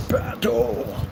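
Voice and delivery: high, stylised war cry without real volume; mock battle cry. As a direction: cry it out like a hammed-up warrior. Mock-epic cry after doing battle.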